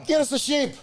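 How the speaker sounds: high hammed-up Irish lad voice; rally the boys like a drunk Irish lad, high; high mock Irish lad calling out. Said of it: "I bet they would have just been like".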